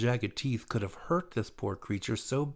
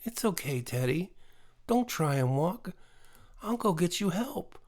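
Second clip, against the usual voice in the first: a hint of a child reassuring a hurt animal; gently voiced young girl; comforting little girl; 7 semitones higher than usual. A narrator's reading.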